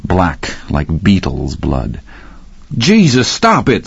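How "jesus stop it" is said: sharp high yell to stop a fight. A narrator's reading. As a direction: shout it, pulling someone off. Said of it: "somebody yelled".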